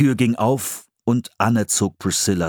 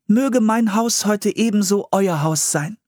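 high, formal blessing; Priscilla's welcome; 12 semitones higher than usual. A narrator's reading. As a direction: offer a gracious welcome in a high voice. (feierlich willkommen heißend, hohe Stimme)

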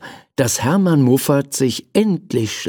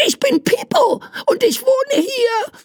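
tiny creature voice. Acted. Tiny creature introduces itself.

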